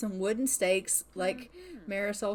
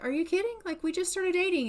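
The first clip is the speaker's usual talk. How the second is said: high protest; 8 semitones higher, faster than usual. Pretend. Protesting the new girlfriend leaving.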